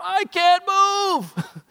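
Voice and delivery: put-on high, slow frightened child wail; a stuck boy crying out in fear. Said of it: "he's crying out".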